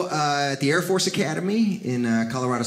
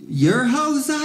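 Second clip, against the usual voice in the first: high, incredulous "You're Jose?"; a doubtful father at the door; dad's surprised question; 6 semitones higher than usual. Retold or imitated.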